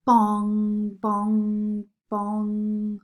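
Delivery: booming clock strikes; clock bong. A narrator's reading. Narrator sounding the midnight clock, booming bongs. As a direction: ring out each bong like a great clock.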